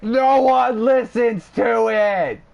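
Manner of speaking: high, loud, slow yell; screamed. Retold or imitated. He screams at Justin about the podcast, high and loud. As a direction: shout it right at him. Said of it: "I fucking screamed it at him".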